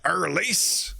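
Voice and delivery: Irlise as "er-leese" with a rising tune; imitating Irlise lightly; mock Irlise. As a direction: quizzical Irlise?. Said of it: "he said it like that".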